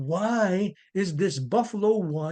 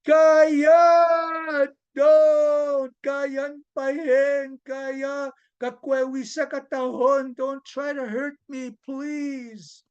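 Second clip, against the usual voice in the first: high, drawn-out yell easing into pleading; storyteller's full-voiced yell for mercy, fading to a plea; 6 semitones higher, 5 dB louder, much slower than usual. A narrator's reading. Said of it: "Wisagetsak was yelling".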